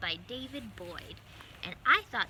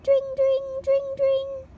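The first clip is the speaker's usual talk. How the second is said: much higher, louder sing-song ding of a bell; bicycle bell; 15 dB louder, much slower than usual. Acted. Mice ringing bicycle bells on the way, sung bell sound. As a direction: ring it out like a little bike bell.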